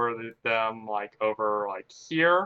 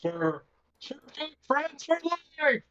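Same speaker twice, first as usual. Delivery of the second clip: high squeaky echo. Acted. Player echoes the NPC voice.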